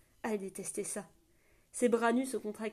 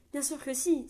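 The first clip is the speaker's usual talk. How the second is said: raised, louder exclamation; cried out; 5 semitones higher, 5 dB louder than usual. A narrator's reading. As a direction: blurt it out eagerly. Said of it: "s'écria Elinor".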